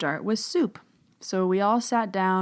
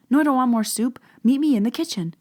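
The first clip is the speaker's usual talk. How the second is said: louder, higher exasperated push; 7 semitones higher, 6 dB louder than usual. Retold or imitated. To Susan who keeps offering soup, exasperated. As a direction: blurt it out, fed up. Frustrated with Susan not getting it.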